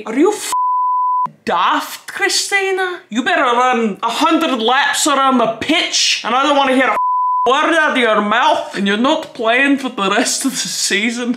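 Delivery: scolding coach in a badly faked Scottish accent; terrible Scottish accent. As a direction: bark it like an angry Scottish soccer coach. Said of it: "That was a terrible Scottish accent".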